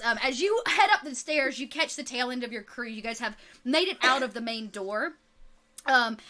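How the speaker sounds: ordinary talk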